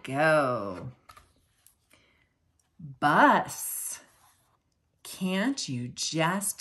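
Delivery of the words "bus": ordinary delivery, nothing marked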